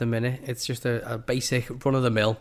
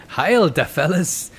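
hearty, drawn-out mock German greeting; put-on German accent, cheery salute; loose German accent; 5 semitones higher, 5 dB louder, slower than usual. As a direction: hail your pals in a hammy German accent. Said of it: "I'm doing a very loose German accent".

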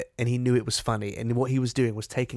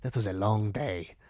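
imitating a weary groan; 2 semitones lower, slower than usual. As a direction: groan as if lowering yourself into a chair. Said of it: "he makes more of a noise".

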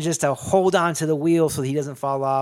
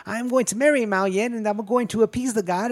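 put-on higher-pitched old villain boasting; David Lopan; 8 semitones higher than usual. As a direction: lightly imitate a mouthy frail old man. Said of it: "he's talking mad shit".

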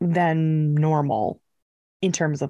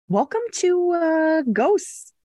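bright, high show-opening voice; podcast intro announcer; 10 semitones higher, slower than usual. Acted. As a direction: announce the show title with a flourish.

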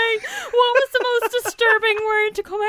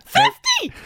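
high, incredulous yelp; horrified disbelief; 4 dB louder than usual. Real, not acted. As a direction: shriek it back in alarm. Horrified at shock setting.

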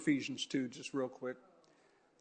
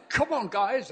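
raised, rallying mock South African call; South African accent; 7 semitones higher, 4 dB louder than usual. Imitating guest preacher for the congregation, loose South African accent. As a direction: cheer them up in a rough South African accent. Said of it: "I can't do the South African accent well enough".

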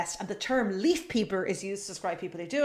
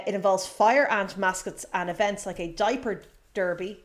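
ordinary talk

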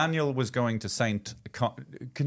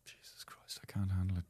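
breathy whisper, much softer; whispered exasperation; 3 semitones lower, 11 dB quieter than usual. Real, not acted. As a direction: whisper it in despair. Hears the phone was wrecked.

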